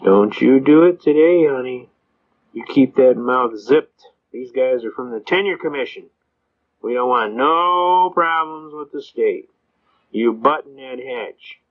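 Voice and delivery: judge's drawn-out warning, imitated; slow mock warning. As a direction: stretch each order out slowly to shut someone up. Judge warns prosecutor, acted in retelling.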